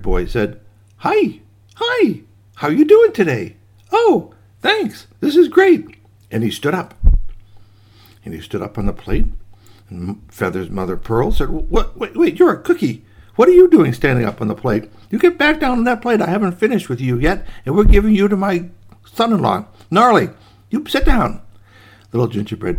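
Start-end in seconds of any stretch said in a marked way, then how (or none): 1.0-6.0 very high, squeaky little cookie voice; cheery gingerbread boy. Acted. Newborn gingerbread boy greets his maker. 11.5-21.4 high, flustered telling-off; startled scolding. Acted. Startled woman scolds the cookie.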